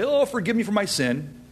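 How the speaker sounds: tossed-off apology, quick and high; mock a breezy, careless confession; high, hurried, offhand plea; nonchalant, casual. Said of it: "if I just nonchalantly, casually say".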